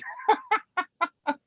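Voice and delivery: high-pitched laugh after a remark; short burst of amused laughter; real chuckle. Real, not acted. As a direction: laugh at your own anecdote. Amused by grandkids' song choice.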